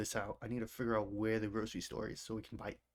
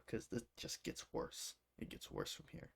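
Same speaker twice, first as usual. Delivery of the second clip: whispering; 8 dB quieter than usual. Real, not acted. Warning viewers, voice drops to whisper.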